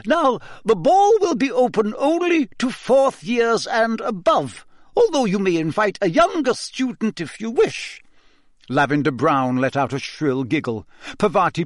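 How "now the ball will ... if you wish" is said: high, clipped Scottish schoolmistress; a strict old teacher addressing the class; stern McGonagall. Acted. Teacher announcing the ball to the class, stern and high.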